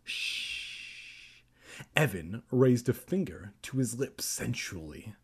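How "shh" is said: drawn-out hushing sound; quiet, voiceless shush; long soft shush. A narrator's reading. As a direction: hush someone slowly. Kermit hushes Evan.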